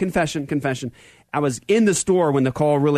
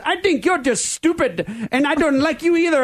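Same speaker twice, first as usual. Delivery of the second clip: put-on high, offended Indian-accented caller; peeved imitated Indian scammer; 11 semitones higher than usual. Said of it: "with a strange Indian accent".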